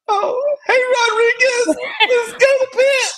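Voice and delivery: very high, whimpering mock cry; fake-sob the line like a scared recruit.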